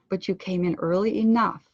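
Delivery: weight on EARLY ENOUGH at the end; phrase ending landed. Shown as a demonstration. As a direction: let "early enough" land loud and clear. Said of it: "allow the end of the phrases to land".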